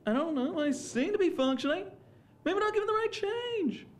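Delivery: very high, strained observational whine; Jerry Seinfeld. Acted. Stand-up comedian as courtroom lawyer, high incredulous whine. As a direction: pitch way up, questioning every phrase. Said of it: "Jerry Seinfeld in And Justice for All".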